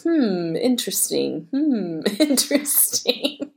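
drawn-out, noncommittal musing; mock intrigued. Mom practising her reply to her son's plans, mock intrigued.

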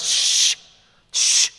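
loud unvoiced shushing; loud crowd shush. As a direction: hush him harshly, like the crowd. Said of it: "The fickle crowds".

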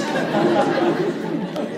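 a breathy little laugh at the gasp; airy laugh. Real, not acted.

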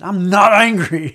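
loud, drawn-out mock-angry retort; angry yell back. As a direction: yell a denial back, playing up the anger. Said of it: "I yelled back at him".

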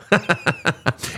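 short, high chuckle; amused laugh. Real, not acted. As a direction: laugh at your own joke. Laughs at his own quoted catchphrase.

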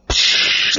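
voiceless hissing splash; splashdown whoosh. Acted. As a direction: imitate a ship hitting the water. Imitated splash of landing spaceship.

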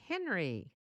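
ordinary delivery

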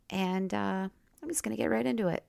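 normal talking voice

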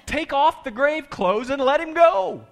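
high, loud, slowed-down shout; big, drawn-out impatient holler; exasperated command. Acted. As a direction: yell the order at stunned onlookers. Jesus ordering the frozen onlookers.

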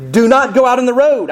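put-on high, loud stern parent warning a child. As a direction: lay down the law to your kid, firm and sharp. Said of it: "It's my parent voice".

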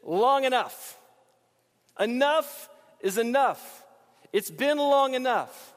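slow, weary, fed-up cry; exasperated plea. Shown as a demonstration. Preacher voicing the people's exasperation to God. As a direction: drag it out like you've had enough. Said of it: "what we're really saying is".

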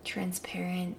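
soft breathy whisper. Acted. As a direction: whisper it gently. Whispered word in meditation.

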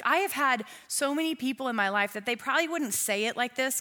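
usual speaking voice

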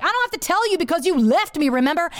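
high-pitched, exasperated comeback; indignant retort. Retold or imitated. Wife snapping back at the husband who left her, indignant. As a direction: throw it back at him, pitch up.

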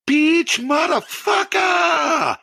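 put-on high, drawn-out scream in a thick African English accent. African mother screaming at pest control caller, thick accent. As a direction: imitate a furious African mother screeching down the phone. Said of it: "in the thickest African".

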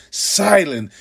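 ordinary delivery

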